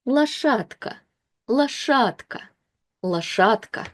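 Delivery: лошадка said lashatka, stress on А, Д said as T; careful Russian reading. Shown as a demonstration. Teacher reading лошадка aloud for learners, careful. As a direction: sound out лошадка with a voiceless T. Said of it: "So we will read it as".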